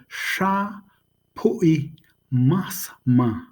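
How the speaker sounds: "Sha... Pui... Mas... Ma", slow with gaps between syllables; drawn-out, separated syllables on "Sha Pui Mas Ma"; slow demonstration. Shown as a demonstration. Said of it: "you probably could say it that slow".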